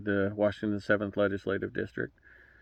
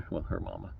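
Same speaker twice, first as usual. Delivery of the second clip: laughing softly; 2 semitones lower, 12 dB quieter than usual. Real, not acted. Correcting himself with a chuckle.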